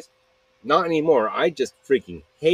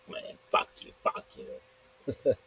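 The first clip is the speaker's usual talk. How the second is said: whispered mock cursing, much softer; muttered retort; 3 semitones higher, 10 dB quieter, slower than usual. Rejected man to the person threatening police, muttered mock retort. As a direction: curse them under your breath in a retold comeback.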